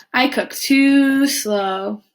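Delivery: very slow, drawn-out words; slow turtle. Acted. Turtle telling Iguana why he won't help, very slow. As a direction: drag every word like a sleepy turtle.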